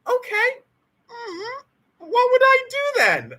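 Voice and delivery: high, loud mock bafflement; mock puzzled reply. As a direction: shrug it off in a squeaky voice. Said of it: "I'm like".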